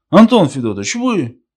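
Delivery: high, loud surprised question; startled recognition. A narrator's reading. Fedka lights up the old man's face, startled recognition.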